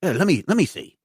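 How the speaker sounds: high, eager Cockney-ish bloke; grabby pub lad wanting a look; lightly voiced eager pub player; rough snooker player.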